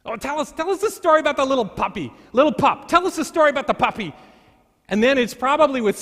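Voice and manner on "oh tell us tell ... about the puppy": put-on higher, fast begging kid voice; eager kids. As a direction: plead like excited little children at bedtime. Children begging grandpa for story.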